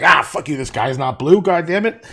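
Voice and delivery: loud cranky contrarian outburst. Acted. Contradicting a friend about the sky, hammed-up cranky. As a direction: snap back crankily, playing up the grouchiness. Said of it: "say it in a cranky way".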